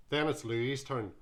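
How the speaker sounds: ordinary delivery, nothing marked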